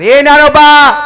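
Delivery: high, loud summons; calling out. Shown as a demonstration. Parent calling a child by a saint's name, calling out. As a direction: holler the name as if for your child. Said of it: "हाक मारताय लेकराला".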